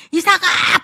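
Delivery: Abraham calling his son Isaac, loud and high. Acted. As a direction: call the boy's name across a field.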